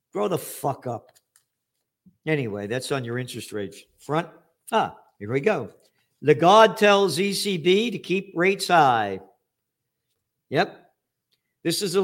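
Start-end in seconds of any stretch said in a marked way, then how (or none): none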